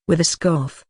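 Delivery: plain reading voice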